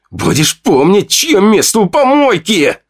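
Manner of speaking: slow menacing threat. Acted. Fedya threatens the narrator, putting him in his place. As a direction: rub his nose in it.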